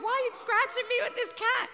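high, quick mock complaint; husband's sleepy protest. Husband's sleep-talk complaint acted out.

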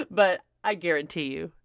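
usual speaking voice

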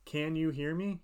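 usual speaking voice

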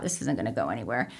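low, quiet, quick doubtful aside; skeptical inner thought. Retold or imitated. Thinking back at the race, quiet and doubtful. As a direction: mutter it to yourself, doubting. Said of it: "you know, I thought".

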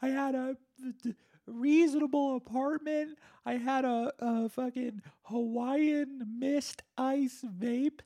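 very high, strained whine of a washed-up self; future self lament. Retold or imitated. Future self watching old podcast, whining high. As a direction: whine like a future loser mourning lost luxuries. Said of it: "I'll be like".

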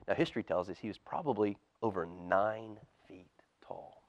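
put-on whispered fact for the children; storyteller whisper to little listeners; hushed aside. A narrator's reading. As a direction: lean in and whisper it.

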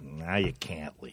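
slow, low, soft movie threat; quiet menace. Retold or imitated. Retelling gangster who locks the door, hushed mock menace. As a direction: say it slowly, way down low.

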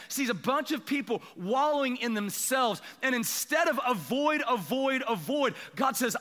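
high-pitched, driving sermon cadence; impassioned preaching. Real, not acted. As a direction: preach it in repeated, building phrases. Preacher builds to a climax.

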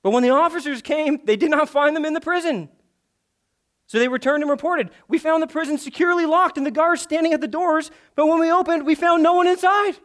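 high, loud dramatic reading; dramatic scripture reading. Acted. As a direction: read it with rising drama. Reading the passage, heightened.

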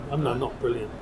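ordinary talk

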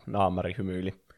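plain reading voice

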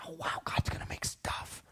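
hushed, voiceless angel whisper; awed whisper. Acted. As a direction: whisper it like an amazed onlooker in heaven. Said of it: "you can hear the angels whisper".

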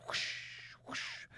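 breathy whoosh of flags; whispered whoosh. Acted. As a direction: blow out a soft whoosh. Imitating flags.

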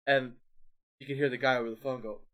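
normal talking voice

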